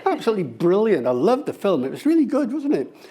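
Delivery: quick, high-pitched gushing; fast informal chat. Shown as a demonstration. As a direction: gush about it in quick casual bursts. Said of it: "more like three hundred syllables a minute".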